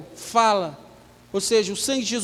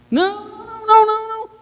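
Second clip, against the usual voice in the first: high, loud panicky refusals; fearful people refusing; 10 semitones higher, 4 dB louder, faster than usual. Retold or imitated. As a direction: play the people of Israel begging off in fright. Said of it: "o povo não quis ouvir a Deus?". (o povo recusando, em pânico e agudo)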